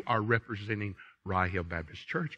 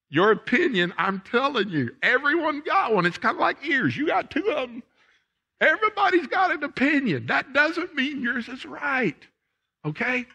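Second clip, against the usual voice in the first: much higher, punchy and teasing; animated preaching; 14 semitones higher than usual. Real, not acted. Preacher jokes to the congregation about opinions, high and animated. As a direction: tease the crowd with the ears joke.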